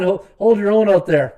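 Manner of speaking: usual speaking voice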